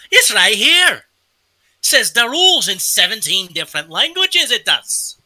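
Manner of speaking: loud, high-pitched bartender voice; gruff barkeep. Acted. GM as barkeep shows the players his rulebook, loud and proud. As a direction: bellow it across the bar like a proud barkeep.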